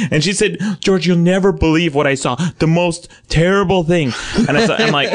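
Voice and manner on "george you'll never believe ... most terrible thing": scandalized mother, pitched up; higher-voiced imitation of an upset mom; frantic mom. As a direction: confide the horror in mock distress. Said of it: "frantic. She's crying".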